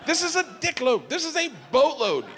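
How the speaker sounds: high, laughing outrage; outraged rant. Retold or imitated. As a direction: squeal it in disbelief. Indignant rant at his wife.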